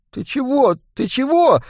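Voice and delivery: high-pitched stalling babble; flustered babbling. A narrator's reading. Boy cornered by thugs stalling for time, flustered. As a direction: babble it high, playing scared and clueless. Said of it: "забормотал Жига".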